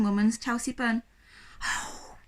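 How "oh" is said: soft, voiceless little whisper. A narrator's reading. Sophie alone in her room finding the bun, whispering. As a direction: breathe it out under your breath. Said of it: "whispered Sophie".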